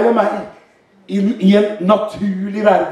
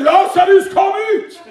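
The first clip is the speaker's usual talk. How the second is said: high, ringing preacher's cry; forceful high summons; commanding shout; 9 semitones higher than usual. Retold or imitated. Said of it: "Han bare ropte".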